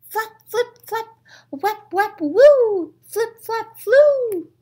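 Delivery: high sing-song flaps and woos; fluttery flap-flip noises; flapping sound effects. Acted. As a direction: chant the bat wings up high. Bat wings sound effects.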